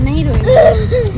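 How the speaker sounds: high, slow, loud comforting; soothing hush. Real, not acted. Mother hushing crying child at farewell, slow and high.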